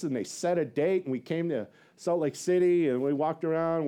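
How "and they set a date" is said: ordinary talk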